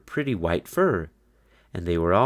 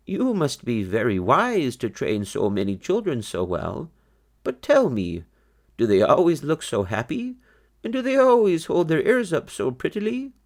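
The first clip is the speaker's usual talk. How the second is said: put-on raised, kindly old rabbit voice; old grandfather bunny; 8 semitones higher than usual. A narrator's reading. Grandfather bunny judging the fast rabbits, higher voice. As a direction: lift the voice for the wise old grandfather.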